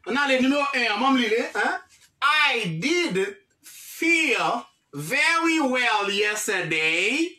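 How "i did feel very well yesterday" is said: very slow, deliberate dictation; slow model reading. Shown as a demonstration. Teacher reading the sentence slowly to the class. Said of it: "teacher reads model sentence slowly".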